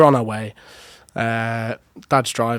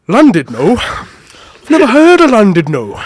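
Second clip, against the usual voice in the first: loud, high, slow mock Welsh local; hammed-up Welsh bloke, loud and high; bad Welsh accent; 10 semitones higher, 14 dB louder, slower than usual. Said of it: "a horrible Welsh accent".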